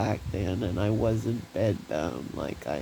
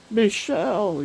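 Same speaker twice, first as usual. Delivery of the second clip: name called out high and louder; calling a name; 10 semitones higher, 4 dB louder than usual. Acted. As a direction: lift the name as if calling her. Brother calls her name in poem.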